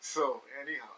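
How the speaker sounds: usual speaking voice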